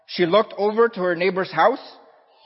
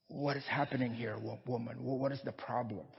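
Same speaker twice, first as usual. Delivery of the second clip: put-on soft, much quieter old man asking in wonder; frail old man; 4 semitones lower, 15 dB quieter than usual. Old man asks the woman.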